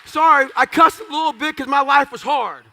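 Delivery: loud laughing aside to the crowd; laughing apology. Real, not acted.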